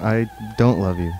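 mock flat refusal. Mock love exchange, slow and far.

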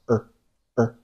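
her said as er with no h, slowly; weak her as er. Shown as a demonstration. Teacher demonstrating weak form of her. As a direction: drop the h and say er. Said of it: "her是弱读，读成了er".

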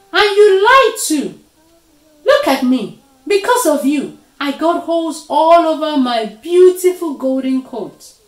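high, loud wailing complaint; indignant shouting, full of grievance; angry outburst. A narrator's reading. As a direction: rage over what was done to you. Leopard rages over his burnt coat.

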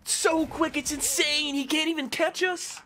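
very high, loud mock excitement; hammed-up sarcastic celebration; sarcastic glee. Real, not acted. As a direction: sarcastically cheer the slow speed. Sarcastic about slowness.

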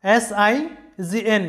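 usual speaking voice

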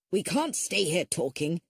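usual speaking voice

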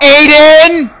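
loud, high call; angry shout. Real, not acted. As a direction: yell his name. Shouting friend's name.

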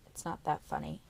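low, soft grumble. Acted. Girl grumbling at Carla, low and soft. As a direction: mutter it, annoyed. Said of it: "I grumble".

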